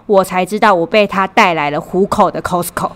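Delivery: ordinary talk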